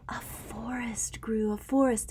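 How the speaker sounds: a gasp, then a soft whisper. A narrator's reading. Reading to a child, a gasped whisper of wonder. Hushed wonder over picture.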